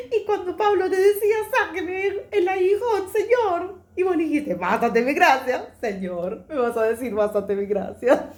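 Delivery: high, loud, laughing protest to God; half-laughing, mock-indignant plea; laughing complaint. Woman laughingly complaining to the Lord about her husband, high and loud.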